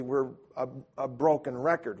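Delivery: usual speaking voice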